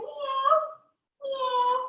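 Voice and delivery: high little kitty meows; cat meow. Shown as a demonstration. Teacher demonstrating cat pose for children, high meows. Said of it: "And then cat pose".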